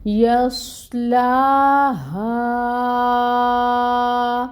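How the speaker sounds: slow chanted یصلاها, the madd drawn out long and high; recited with long madd. Shown as a demonstration. Teacher demonstrates the long madd, slow chant. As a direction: stretch the madd in یصلاها four to five counts. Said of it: "لمبا کھینچیں گے".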